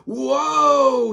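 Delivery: loud high whoa; a wobbly loud cry of fun; screamed. A narrator's reading. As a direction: scream it while teetering. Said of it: "he screamed".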